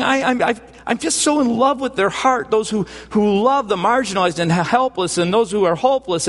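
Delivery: high, impassioned gushing; proud big brother. Acted. Jesus praising to God those who love the helpless, gushing with pride. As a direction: pour out adoring praise in a lifted voice. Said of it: "He, he, he basically is saying".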